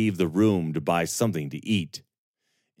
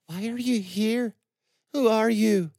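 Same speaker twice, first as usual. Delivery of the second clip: put-on high, far young woman's voice, broken by grief; Serana, grieving. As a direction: voice her like a shaken, grieving girl. Serana questions Aaron.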